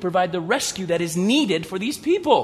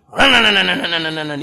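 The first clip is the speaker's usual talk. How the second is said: urgent louder repeated cries; panicked running; 6 dB louder, faster than usual. Acted. Townspeople fleeing the idol smashing, panicked cries. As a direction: shout it like people fleeing.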